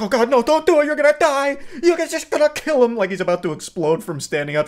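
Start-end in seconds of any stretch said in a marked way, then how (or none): 0.0-3.0 high, hammed-up panicked woman voice. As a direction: shriek mock warnings like a frantic wife. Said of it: "she's like".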